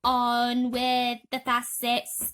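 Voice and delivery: slow, loud booming call; distant shout. Acted. Cloud man calling from above, slow booming shout. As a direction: boom it down from the sky. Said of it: "it shouted".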